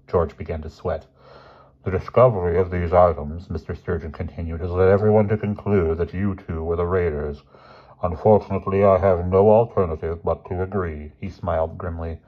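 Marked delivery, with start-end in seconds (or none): 1.8-3.5 lightly voiced grave headmaster; formal, measured disapproval; stern headmaster. Headmaster laying out evidence to two boys, lightly stern. 4.6-11.1 lightly voiced grave headmaster; formal, measured disapproval; stern headmaster. Headmaster laying out evidence to two boys, lightly stern.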